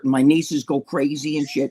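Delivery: normal talking voice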